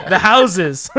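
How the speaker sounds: laughing while talking. Real, not acted. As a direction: say it through a real laugh. Laughing over the houses.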